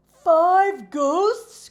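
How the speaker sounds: high, loud frightened question; scared horse, loud and high; alarmed cry. A narrator's reading. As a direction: gasp it out in alarm. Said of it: "cried Coco".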